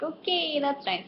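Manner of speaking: high, soft mock-polite reply; small placating voice, acted; polite brush-off. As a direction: softly humour a rude customer. Cashier's meek reply to rude customer.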